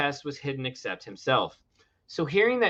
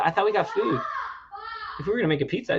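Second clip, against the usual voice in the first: exasperated; 4 semitones higher, 4 dB quieter, much faster than usual. Real, not acted. Arguing over pizza.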